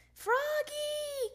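high, drawn-out call. A narrator's reading. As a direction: librarian calling a child to order. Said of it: "called Miss Otterbottom".

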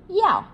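Dạo started high and loud, Mandarin yào; Dạo punched high then dropped. Shown as a demonstration. Teacher shows students to start Dạo high. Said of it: "Cao lên".

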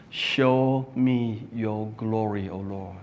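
ordinary talk, nothing marked

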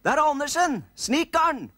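put-on high, loud boy's shout; shouting into an ear. Eldest nisse boy shouting into old grandpa's ear, high loud. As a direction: yell it into grandpa's deaf ear. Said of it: "ropte den største nissegutten i øret hans".

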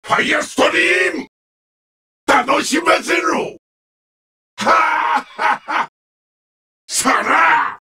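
high, forceful battle cries; attack shouts. Acted. Villain demanding amusement in battle, high attack cries. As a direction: call out each attack with full force.

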